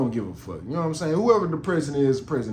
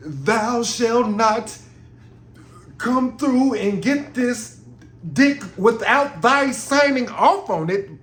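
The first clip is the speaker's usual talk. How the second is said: mock Shakespeare; 7 semitones higher, 4 dB louder, much slower than usual. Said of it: "talking like William Shakespeare".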